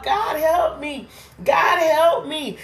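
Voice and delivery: high, slow pleading cry; screaming out. Retold or imitated. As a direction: beg upward, slowly. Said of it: "he's still screaming out".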